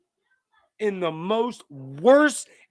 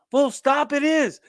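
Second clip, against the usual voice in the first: emphatic declaration; 11 semitones higher, 4 dB louder than usual. Real, not acted.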